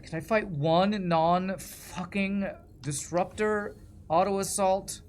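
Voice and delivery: real frustration. Real, not acted. Gamer fed up with the ships he keeps facing, exasperated. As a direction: vent it slowly, pitch pushed up in exasperation. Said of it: "so frustrating".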